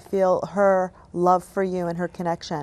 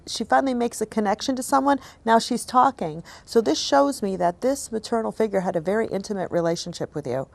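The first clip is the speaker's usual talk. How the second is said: normal talking voice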